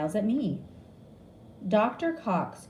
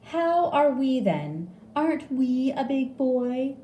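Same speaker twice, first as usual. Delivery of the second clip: put-on baby talk; 7 semitones higher, 4 dB louder than usual. Mocking how nurses talk to babies, high baby talk. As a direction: coo it like talking to a toddler. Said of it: "they say to babies".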